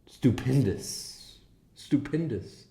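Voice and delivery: stupendous said slowly and grandly; stupendous, each syllable drawn out. Retold or imitated. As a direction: stretch out stupendous slowly, savoring it. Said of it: "he said it's".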